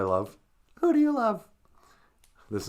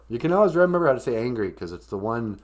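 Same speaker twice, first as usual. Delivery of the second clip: ordinary talk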